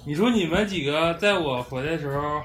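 normal talking voice

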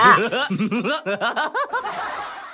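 long high-pitched laugh. Acted. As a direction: burst out laughing after the punchline. Laugh after the punchline.

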